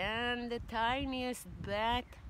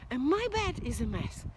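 lightly voiced mommy bear, higher; a hint of a fussing mother bear; mock-indignant mommy bear; 6 semitones higher, 6 dB louder than usual. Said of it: "goes Mommy Bear".

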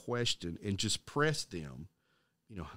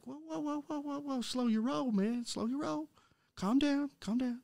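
high soothing whoa. Retold or imitated. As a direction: coax him down gently, pitched way up. Calming an irate classmate.

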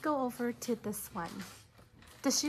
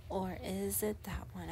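whisper; 3 semitones lower, 5 dB quieter than usual. Real, not acted. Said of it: "whispered question to class".

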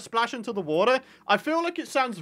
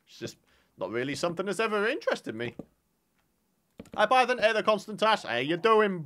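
ordinary delivery

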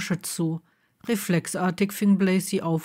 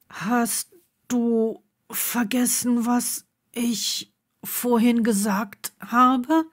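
slow, higher, out of breath; breathless, in bursts; 5 semitones higher, slower than usual. A narrator's reading. Neville still breathless after the kiss, reminding Blaze. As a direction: push the words out between breaths. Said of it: "brachte er stoßweise hervor".

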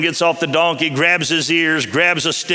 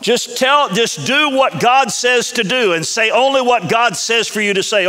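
angel's firm command; 6 semitones higher than usual. Acted. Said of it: "He said".

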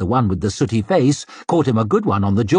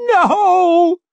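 very high, loud, crying scream; terrified wail; 6 dB louder than usual. A narrator's reading. Maid clinging to the gate frame, wailing. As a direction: wail it out in desperation. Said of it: "she squealed".

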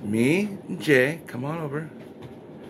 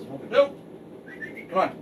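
calling from far away; 6 semitones higher than usual. Real, not acted. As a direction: call the dog from across the yard. Calling dog from distance.

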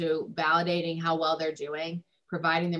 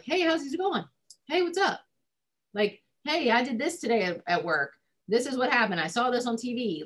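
much higher, breezy, easygoing hello; casual friendly greeting; 6 semitones higher than usual. Shown as a demonstration. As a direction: chirp it like a light everyday check-in. Casual chit-chat with the kid.

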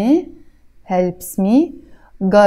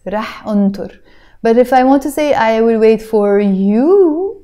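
normal talking voice

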